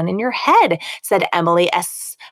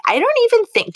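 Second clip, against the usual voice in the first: put-on high, snooty Valley Girl. Shown as a demonstration. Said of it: "a Valley Girl voice".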